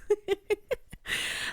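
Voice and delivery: real high giggle; amused laugh. Real, not acted. Reader tickled by voicing the shy pet, high giggle. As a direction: giggle at your own words.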